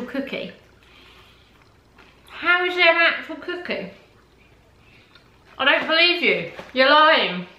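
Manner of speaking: words around a bite; chewing while talking. Real, not acted. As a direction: mouth partly full. Seen 1: Bites cookie at -2, jaw and cheeks busy while talking after.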